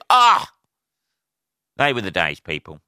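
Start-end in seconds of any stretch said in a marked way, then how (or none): 0.1-0.5 short, high, loud "Ah!"; sudden hammed-up shout; mock yell. Ex-call-centre worker joking about callers, mock yell.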